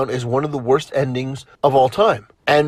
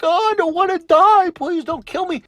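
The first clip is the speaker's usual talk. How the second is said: mock Cersei whimper; 12 semitones higher than usual. Cersei begging not to die, high mocking plea. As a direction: whine it like a scared Cersei begging. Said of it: "She's like".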